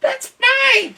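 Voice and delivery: very high squeaky squirrel voice. A narrator's reading. Squirrel answering the duck in a squeak. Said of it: "squeaked the squirrel".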